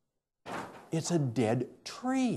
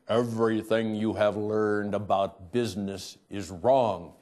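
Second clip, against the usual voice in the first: lightly imitated German accent, lower voice; 6 semitones lower than usual. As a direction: quote him with a low, measured German accent. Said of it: "in his kind of German accented".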